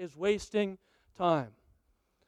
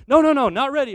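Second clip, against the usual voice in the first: scolding no; 4 semitones higher, 9 dB louder, faster than usual. Retold or imitated. Dad scolding son at strawberry.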